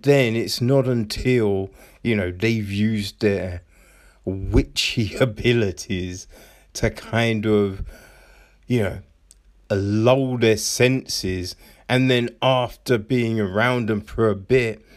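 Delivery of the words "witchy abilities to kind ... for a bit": ordinary talk, nothing marked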